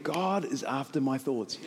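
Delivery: low, soft, slow; quiet inner realization. Retold or imitated. Pastor's message coming into his head, quiet realization. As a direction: let it drop in as a hushed thought.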